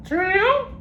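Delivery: very high squawky parrot speaking. Acted. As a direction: talk back as a cheeky parrot, very high. Parrot repeats syllable.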